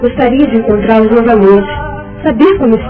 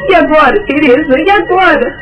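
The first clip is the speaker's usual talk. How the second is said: crying out in grief; high, tearful, despairing cries; sobbing; 6 semitones higher than usual. Acted. Widow learns husband died.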